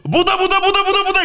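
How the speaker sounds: very high, loud, rapid-fire cry; mock frantic panic. Falling man in a joke switching religion, mock frantic panic. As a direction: beg another god at the last second, hammed-up.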